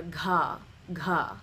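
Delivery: gha with a puff of air after the G; aspirated gha. Shown as a demonstration. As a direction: breathe out through the G in gha. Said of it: "aspirated are gonna have an H".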